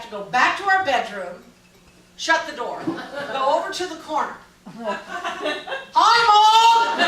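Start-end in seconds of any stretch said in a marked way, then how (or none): 4.7-7.0 high, loud phone shouting, laughing through it; laughing mock yell on a phone call. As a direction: bellow into the receiver for a deaf mother. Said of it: "you would be yelling the whole time".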